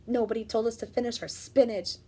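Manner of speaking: usual speaking voice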